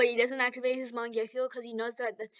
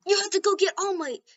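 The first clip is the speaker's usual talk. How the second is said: high, pressing call; urgent order; 8 semitones higher, 4 dB louder than usual. Acted. Someone sending Deku for All Might, urgent. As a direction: send someone running for help.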